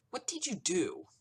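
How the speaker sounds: angry demand. Acted. Celeste furious.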